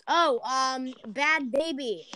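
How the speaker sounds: usual speaking voice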